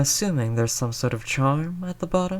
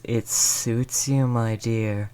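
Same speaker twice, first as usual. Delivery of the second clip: ordinary delivery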